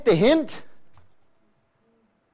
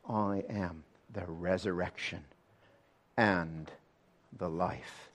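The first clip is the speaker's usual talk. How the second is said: hushed quote; 10 semitones lower, 14 dB quieter, slower than usual. Acted. Preacher hushes the congregation to quote Jesus softly. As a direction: drop to a near-whisper, soft and low.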